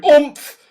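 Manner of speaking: loud, high bear grunt; oomph grunt. A narrator's reading. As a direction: grunt as a snowball hits.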